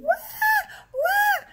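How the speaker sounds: short, very high loud wails; hammed-up repeated shriek; screaming. Old mother keeps up the noise at police, screaming. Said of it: "she kept on screaming".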